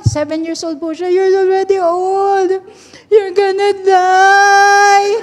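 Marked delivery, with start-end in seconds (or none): 1.1-5.1 high, slow mock wail, stretching to the end; imitated crying child. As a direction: bawl it out like a distraught little kid. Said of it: "iyak siya na iyak". (kunwaring ngumangawa na parang bata)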